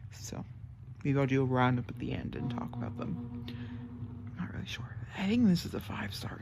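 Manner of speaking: quiet whispered aside to viewers; whispered update. Real, not acted. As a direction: whisper it all, no voice.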